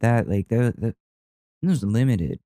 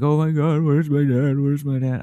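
panicked; 6 semitones higher than usual. Retold or imitated. Kid panicking on the mountain, lost dad, high and frantic. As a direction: retell the panic as a scared kid. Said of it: "freaking out".

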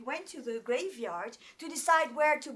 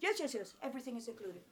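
rattled off fast and quiet; quick brushing-off yes; 7 dB quieter, much faster than usual. Retold or imitated. Local man brushing off her worry, fast and quiet. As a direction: dismiss the question in a quick mutter.

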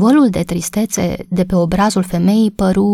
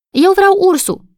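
put-on much higher, a small child crying out; child's shout; 12 semitones higher, 3 dB louder than usual. A narrator's reading. The youngest boy calls out for the toy bear, high child's shout. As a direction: blurt it out like the littlest boy. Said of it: "strigă cel mai mic".

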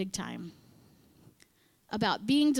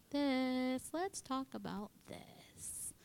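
slow, softer whispered nudge; 4 semitones higher, 8 dB quieter, much slower than usual. Retold or imitated. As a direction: whisper slowly like a nighttime voice. Sneaks up at three a.m.

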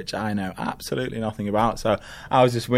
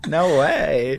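mock American "no way", a little higher; laughing imitation of an American; American impression; 5 semitones higher than usual. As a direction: tease with an exaggerated American accent. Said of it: "that's pretty good".

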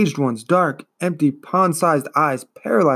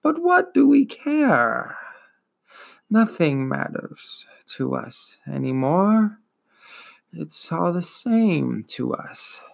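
slow, dragging, wheezing ancient voice; wheezing old turtle; 4 semitones higher, slower than usual. Acted. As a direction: drawl it out like a weary old beast. Turtle voice continues.

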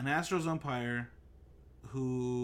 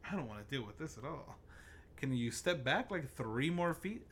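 softer, put-upon horrified muttering; mock disgust; 5 dB quieter than usual. Imagined listener to someone with bad breath, mock disgust. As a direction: play appalled by someone's breath.

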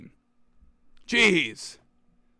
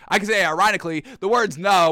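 ordinary delivery

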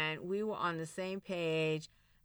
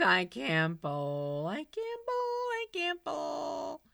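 slow, then much higher, each take drawn out; mock wounded repeat; 7 semitones higher, slower than usual. Mocking the ex's jab in a high whine. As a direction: whine it back like a hurt kid. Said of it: "And I was like".

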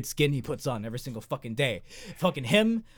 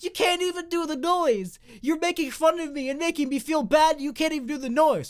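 hurt whiny voice; 10 semitones higher than usual. Retold or imitated. Bald man complaining they mock him, high wounded whine. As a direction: sound hurt and picked on. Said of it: "And he's like".

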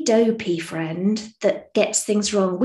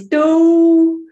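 loud, higher, drawn-out cartoon doh; Homer Simpson; 8 semitones higher, 10 dB louder than usual. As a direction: belt out a long cartoon catchphrase on one held note. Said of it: "hear him say in his voice".

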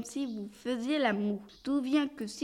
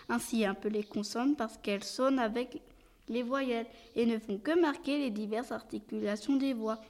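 ordinary talk, nothing marked

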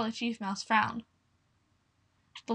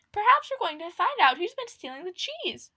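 high mouse voice; 9 semitones higher than usual. Acted. A mouse speaks in story.

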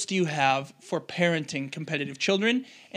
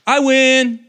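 put-on high, loud little-boy victory cry; kid's triumphant shout; 9 semitones higher, 11 dB louder than usual. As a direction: shout it like a toddler winning a race. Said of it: "And he'll go".

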